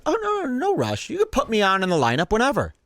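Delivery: high, fast fake-casual offer; mock polite Tim. Commentator mimicking the comedian's fake politeness, high and fast. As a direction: breeze it out, high and overly polite.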